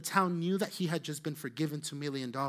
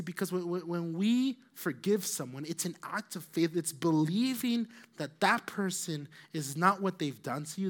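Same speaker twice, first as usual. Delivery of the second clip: ordinary talk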